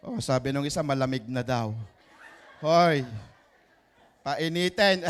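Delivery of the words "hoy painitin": loud, laughing call-out; playful call. Real, not acted. Pastor teasing a cold congregation, a loud laughing call. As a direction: shout it teasingly at the room.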